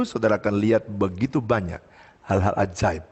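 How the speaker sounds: ordinary talk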